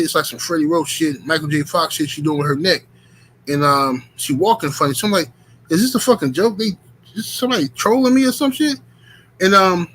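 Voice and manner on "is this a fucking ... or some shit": incredulous. Retold or imitated. Retold disbelief at street girl.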